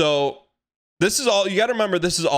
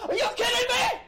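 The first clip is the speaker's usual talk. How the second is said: half-laughing mock outrage, way up high; incredulous shout; 4 dB quieter than usual. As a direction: shriek it like the idea is absurd. Kid asks about meeting Misty.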